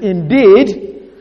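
"indeed" drawn out slowly, leaning hard on "deed"; emphatic. Shown as a demonstration. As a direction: stress on the second syllable of "indeed". Translation showing the apostle's emphasis.

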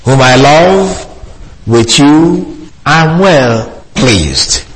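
slow, deliberate reading of the verse; measured scripture recitation; solemn scripture reading. Acted. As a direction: read it out like holy writ. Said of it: "because it says".

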